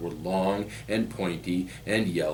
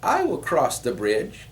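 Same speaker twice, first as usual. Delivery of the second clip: high, louder little billy goat; piping little goat; 8 semitones higher, 5 dB louder than usual. A narrator's reading. Little goat volunteering to his brothers, high piping voice. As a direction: pipe up in a high small voice. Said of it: "little Billy Goat Gruff piped up".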